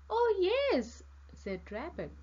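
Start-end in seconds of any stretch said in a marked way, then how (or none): 0.1-1.0 high, loud, delighted yes; excited storybook character, pitched way up; eager acceptance. A narrator's reading. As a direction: accept the invitation with glee. Rabbit accepts a party invitation.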